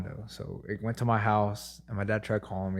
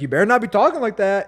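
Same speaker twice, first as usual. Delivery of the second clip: high, loud imitation of an angry mother; mock scolding mom; 9 semitones higher, 7 dB louder than usual. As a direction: call out a mom's warning from another room. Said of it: "I heard my mom in the back".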